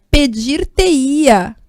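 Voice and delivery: "pedir-te-ia" stretched out syllable by syllable; teacher's slowed-down example "pedir-te-ia"; very slow demo. Shown as a demonstration. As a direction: spell out "pedir-te-ia" very slowly for the class. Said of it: "aí fica assim, olha".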